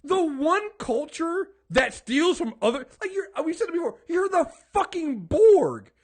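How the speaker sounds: loud, high, fired-up rant; heated rant. Real, not acted. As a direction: let it all out, shouting at them. Ranting about cultural appropriation.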